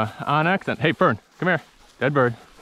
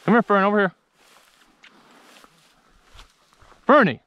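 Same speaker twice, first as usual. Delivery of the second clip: high, louder call, rising to a shout; calling the dog; 9 semitones higher, 4 dB louder, faster than usual. Real, not acted. Hunter calling his dog over to the bird, high and loud. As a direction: bring her in from far off.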